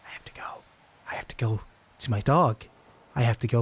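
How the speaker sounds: hushed, breathy whisper. Real, not acted. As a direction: whisper it urgently. Whispering in tense game moment.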